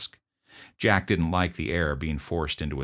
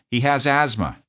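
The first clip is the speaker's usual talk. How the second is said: a coworker yelling from the entryway, higher and louder; yelled; 8 semitones higher, 6 dB louder than usual. Retold or imitated. Staff worker yelling the diagnosis to the officer, loud call. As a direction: call it out across the hall. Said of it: "yelled out to me".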